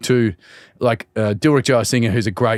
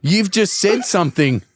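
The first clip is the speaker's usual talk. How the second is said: usual speaking voice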